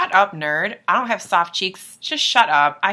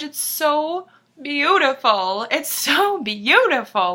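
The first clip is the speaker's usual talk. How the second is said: high, slow, drawn-out rapture; overwhelmed gushing; 8 semitones higher, 5 dB louder, slower than usual. Real, not acted. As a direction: stretch it out, overcome by the song. Gushing over the song.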